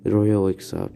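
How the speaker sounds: plain reading voice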